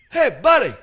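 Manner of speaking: mock Italian painter calling out, high and loud; little Italian voice. The Italian painter calling from the corner, high and loud. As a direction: call out "Hey, buddy" like the little Italian guy. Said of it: "this little Italian voice".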